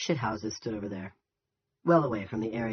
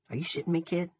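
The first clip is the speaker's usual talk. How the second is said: higher-pitched shocked question; mild disbelief; 7 semitones higher than usual. A narrator's reading. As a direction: ask in understated shock, as the soldier. Soldier hears of the suicide.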